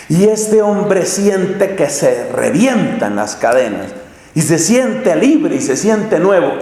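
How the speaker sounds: usual speaking voice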